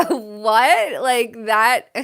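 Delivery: mock shock. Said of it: "I was like".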